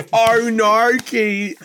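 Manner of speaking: a light Aussie scolding a mate; Australian accent. Retold or imitated. Cohost riffing on the bit, mock Australian accent. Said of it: "saying Italian dishes in an Australian accent".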